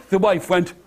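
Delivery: ordinary talk, nothing marked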